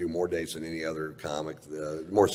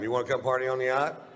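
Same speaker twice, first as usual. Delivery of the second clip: inviting the crowd; 6 semitones higher than usual. Shown as a demonstration. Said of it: "let's try it one time".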